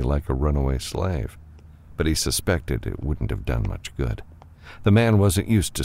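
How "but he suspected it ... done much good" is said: usual speaking voice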